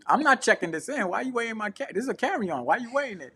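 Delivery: indignant disbelief. Retold or imitated. Retold protest at check-in.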